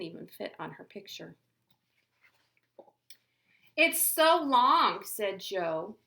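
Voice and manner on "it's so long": slow, loud, teasing drawl; mocking drawl. Joe teasing Chrysanthemum's long name, loud and slow. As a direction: stretch it out to mock her.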